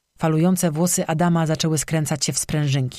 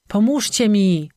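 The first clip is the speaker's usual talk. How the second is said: higher, louder, slow desperate cry; calling for help; 5 semitones higher, 3 dB louder, slower than usual. A narrator's reading. Someone in the forest crying out for help, desperate. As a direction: call out into the dark for rescue.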